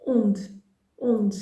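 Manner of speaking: "onde" said as ond, the final e dropped; mute final E. Shown as a demonstration. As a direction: end "onde" on the consonant. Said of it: "You don't really hear e".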